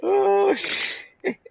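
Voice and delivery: high, loud real yelp; high cry of shock, dropping away; startled. Real, not acted. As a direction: squeal the first word in sudden alarm. Sudden mishap during gameplay.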